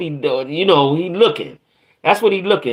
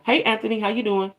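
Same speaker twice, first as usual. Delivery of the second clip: put-on soft, sweet girly greeting; girl voice; 4 semitones higher, 6 dB quieter than usual. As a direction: gently say hi in a girly voice. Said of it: "In my girl voice".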